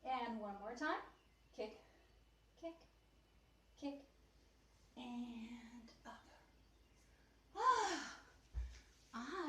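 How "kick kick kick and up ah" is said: breathless, gasping after exertion; winded from the exercise; out of breath. Real, not acted. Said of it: "I need to take a breath".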